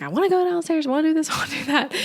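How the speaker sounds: high tearful whine, half laughing; whiny crying. Retold or imitated. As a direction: whimper it out like a frustrated crying mom. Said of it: "with me crying".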